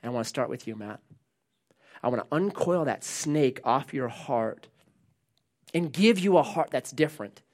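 low, hushed, deliberate promise; God speaking. Acted. God telling Matt he will change his heart, low and quiet. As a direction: drop down soft and low as God. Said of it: "what God is trying to do is this".

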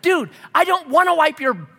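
high, loud fed-up dad; exasperated shout. Retold or imitated. Dad arguing with his toddler, exasperated shout. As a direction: throw up your hands and yell. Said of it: "I'm like".

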